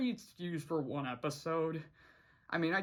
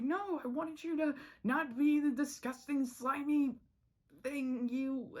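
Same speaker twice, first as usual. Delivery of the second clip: higher put-on Anne, snippy; 9 semitones higher than usual. Anne snapping at Polly during their fight, lightly voiced. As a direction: blurt the insult.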